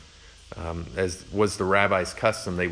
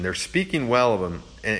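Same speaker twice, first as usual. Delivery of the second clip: ordinary talk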